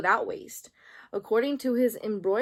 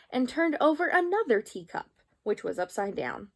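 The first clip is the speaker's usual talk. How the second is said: ordinary delivery, nothing marked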